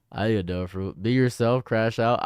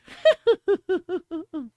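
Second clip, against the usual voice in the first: subtle high villain chuckle; a sly little villain giggle; evil chuckle; 4 dB louder than usual. Acted. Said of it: "This is, like, a subtle one".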